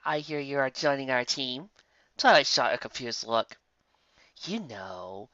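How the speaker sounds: quiet, awkward apology. Acted. Lyra awkwardly apologizing to Twilight after the wedding. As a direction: mumble sorry, embarrassed.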